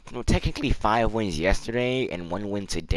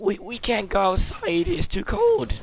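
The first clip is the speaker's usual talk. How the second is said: higher put-on kid voice; pitched-up pretend child, lightly played; voice trolling; 6 semitones higher than usual. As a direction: fake a young kid making excuses. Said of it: "like, um, a voice trolling".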